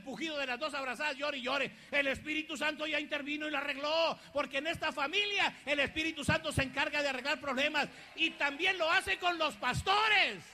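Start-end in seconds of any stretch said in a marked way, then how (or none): 1.9-10.4 louder preacher's build, slowing at the end; loud fervent sermon climax; fervent preaching. Acted. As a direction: drive it home to the congregation. Preaching rises again.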